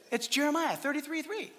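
soft, lightly imitated child; little kid. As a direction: quote a small child quietly. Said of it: "what the little kid said".

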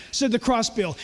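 ordinary talk

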